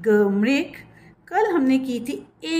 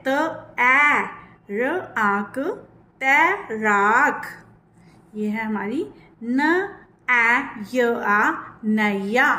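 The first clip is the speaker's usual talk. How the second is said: very slow syllable sounding; slow spelling out; slower than usual. Shown as a demonstration. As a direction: sound out clearly for learners. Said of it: "stress दे रही हूँ".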